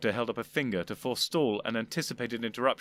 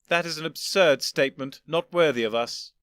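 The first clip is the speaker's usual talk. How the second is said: higher, louder condescending elder gentleman; an old gentleman patiently explaining; lofty old Doctor; 4 semitones higher, 7 dB louder than usual. Acted. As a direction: superior elder explaining to his younger self. First doctor disapproving.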